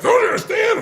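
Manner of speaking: very high, loud mock outburst; shrieked retold exasperation; intense, furious partner. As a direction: imitate a partner losing it. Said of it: "He's like".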